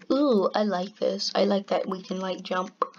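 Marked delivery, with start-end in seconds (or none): none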